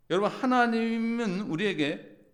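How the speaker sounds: high, loud, slow proclamation; full-force preaching. Acted. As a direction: preach it to the whole hall. Preaching peak. (힘차게 외치는 설교)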